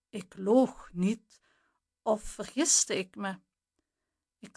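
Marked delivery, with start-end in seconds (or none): none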